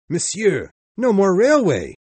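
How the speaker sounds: high, loud alarmed cry. Acted. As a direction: burst in with the bad news.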